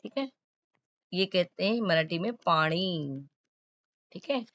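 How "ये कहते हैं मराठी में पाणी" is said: पानी with a retroflex ण, slowly; teacher models पानी with the tongue curled back; Marathi pani. Shown as a demonstration. Teacher showing students the Marathi way to say पानी. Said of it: "हम लोग कहते हैं पानी".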